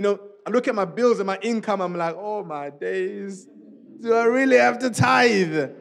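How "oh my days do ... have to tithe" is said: drawn-out reluctant complaint; dragging, put-upon plea; exasperated dismay. Acted. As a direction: stretch it out like a weary protest. Acted dismay at paying tithe.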